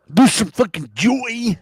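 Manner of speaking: loud, high, slow wrestler shout; Macho Man. Pretend. Podcaster overcorrecting after being teased as joyless, hammed-up wrestler voice. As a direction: belt out mock joy like a hammed-up wrestler. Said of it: "Now you sound like the Macho Man".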